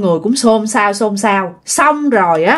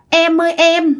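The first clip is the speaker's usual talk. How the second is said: put-on higher-pitched call to a girl; 6 semitones higher than usual. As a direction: call out to catch her attention. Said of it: "Ba tính rằng kêu là". (gọi với cao giọng)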